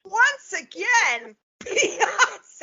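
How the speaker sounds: slow "Once again, Beyonce" with a laugh; wife to husband who missed it, slow and exasperated; exasperated repeat. Retold or imitated. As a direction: spell out "Beyonce" slowly in disbelief. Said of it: "I go".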